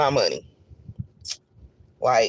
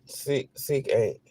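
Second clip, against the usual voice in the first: slow deflated; 3 semitones lower, 8 dB quieter, much slower than usual. Real, not acted. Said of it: "I was sick".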